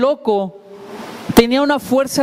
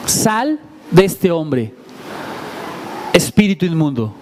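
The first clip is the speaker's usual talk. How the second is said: calm commanding Jesus; 5 semitones lower than usual. Acted. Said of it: "Es lo que le dice Jesucristo".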